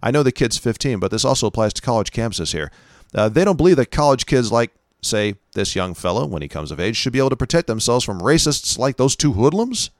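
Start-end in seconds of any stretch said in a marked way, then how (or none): none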